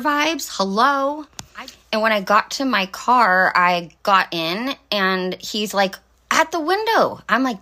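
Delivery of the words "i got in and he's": usual speaking voice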